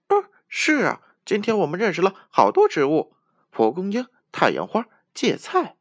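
high, loud, fast chatter; chattering monkey. A narrator's reading. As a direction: rattle off the list excitedly. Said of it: "小猴子不停地说".

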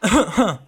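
awkward cough. Acted. Awkward cough before interrupting.

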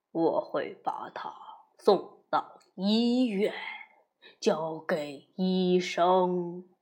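low, slow calm wolf; slowly, deep and steady like a big wolf. Acted. Wolf answers the mother.

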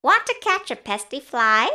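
high, fast storybook rooster; rooster character. A narrator's reading. Rooster invites spider to catch fly, high quick voice. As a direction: ask briskly in a high rooster voice. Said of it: "crowed the rooster".

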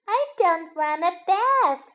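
a hint of a child bunny refusing; little bunny. A narrator's reading. Little bunny protests to mother.

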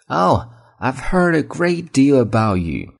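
slow high polite Oh, I've heard a great deal; polite pleased greeting. Shown as a demonstration. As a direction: sound honoured to meet them. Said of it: "你要表示礼貌". (礼貌地表示久仰大名)

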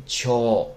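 敲 with its vowel drawn out slowly; long vowel. Shown as a demonstration. Teacher showing the rule for 长, 敲 held long. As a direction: hold the end of 敲 as a long sound. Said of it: "那么就读成长音敲".

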